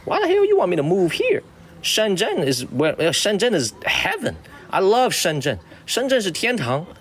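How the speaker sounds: hammed-up disbelief, then gushing about Shenzhen; replaying his own comeback to the assistant; retelling his own reply. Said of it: "I was like".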